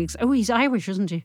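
ordinary talk

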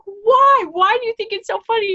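very high, loud and fast; upset demand. Retold or imitated. Roommate demanding why it's funny, high, loud and fast. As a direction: press her for an answer.

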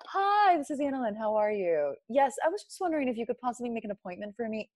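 polite phone voice, opening high. Shown as a demonstration. Caller switching into phone voice to book an appointment. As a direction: put on your sweet, brisk appointment-booking voice. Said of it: "everyone does the phone voice".

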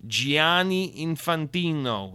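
drawn-out mock Italian name. Podcaster ribbing FIFA's president, mock Italian. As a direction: roll the name out slowly in hammed-up Italian.

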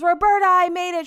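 high, loud joyful shout; excited call. Retold or imitated. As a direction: imitate her calling out to her friend in triumph. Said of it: "she goes".

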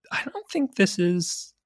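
high-pitched, incredulous realization with a laugh; retold disbelief. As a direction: voice lifts high, questioning your own reaction. Retells own disbelieving afterthought, half laughing.

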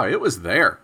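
usual speaking voice

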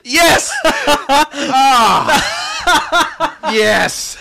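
shouting through laughter; laughing shout. Real, not acted. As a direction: yell it while cracking up. Triumphant joke punchline.

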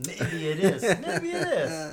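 laughing while talking; giggling through the words. Real, not acted. Admitting the real podcast reason.

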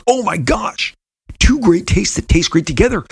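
high, put-on thrilled voice; gushing excitement. [name] retelling his thrilled reaction to Keith, gushing. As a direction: squeal it like a delighted fan.

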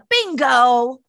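mildly scolding, drawn-out words; mock dog scolding. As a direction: playfully blame the dog.

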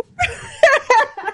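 high laugh mixed with crying; tearful laugh. Real, not acted. Speaker hunting for a tissue mid-story, tearful laugh. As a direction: laugh helplessly through real tears. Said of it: "I don't even know why I'm crying".

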